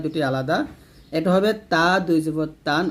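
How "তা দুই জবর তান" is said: তা with a light t and open a, ending তান; thin tā. Shown as a demonstration. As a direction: keep তা light, not rounded like ত. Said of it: "তা এবং ত দুইটা কিন্তু এক হবে না". (তা পাতলা করে, তান)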